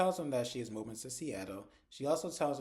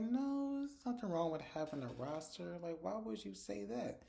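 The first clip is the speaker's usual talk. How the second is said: high-pitched, baffled pushback; squeaky mock-offended friend; Sabrina, indignant; 7 semitones higher than usual. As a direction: raise the voice way up and sound put out. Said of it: "So Sabrina's like".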